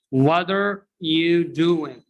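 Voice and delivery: "What are" said slowly as "wadder"; "doing" without the final G, after a linked "wadder you"; slow "wadder you doin'". Shown as a demonstration. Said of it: "So T is pronounced".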